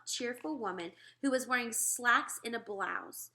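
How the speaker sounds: usual speaking voice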